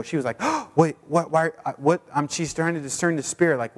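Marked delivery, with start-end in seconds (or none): 0.4-2.0 shocked. Retold or imitated. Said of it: "she was even shocked and fearful".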